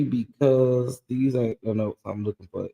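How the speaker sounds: normal talking voice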